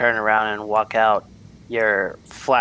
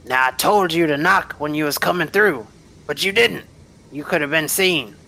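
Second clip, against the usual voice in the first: higher-pitched guard, reproving; scolding guard; 7 semitones higher, 4 dB louder than usual. Acted. Guard tells off visitors for not knocking, higher scolding voice.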